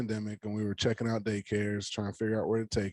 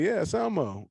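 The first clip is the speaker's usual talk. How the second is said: cheery reassurance; 9 semitones higher than usual. Retold or imitated. Reassuring child.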